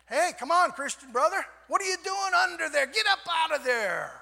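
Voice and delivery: loud, high call to a brother; rousing shout. Acted. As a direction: holler it as if hauling someone up. Replays the quip as rousing call.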